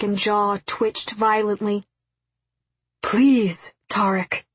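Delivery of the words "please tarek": understated, earnest appeal; pleading. A narrator's reading. X appeals to Tarek after no answer, pleading. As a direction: make a restrained plea. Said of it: "X pleaded".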